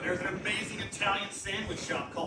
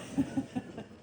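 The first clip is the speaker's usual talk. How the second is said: soft laugh; 5 semitones higher, 4 dB quieter than usual. Real, not acted. Laughing at Colonel Pop Pop.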